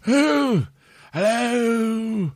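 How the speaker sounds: high-pitched, drawn-out celebrity impersonation. Retold or imitated. Faking a celebrity to ask for tea, high slow impersonation. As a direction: stretch it out high in a mock British rocker voice. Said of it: "a celebrity and be like".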